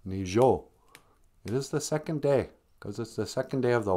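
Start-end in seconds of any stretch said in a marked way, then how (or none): 0.1-0.6 "Nijo" in two syllables, ending on a clear O; Nijo with added O. Shown as a demonstration. Host correcting confused learners, "Nijo" with the O added. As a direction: add an O to the end of "Nijo". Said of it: "you gotta add an O".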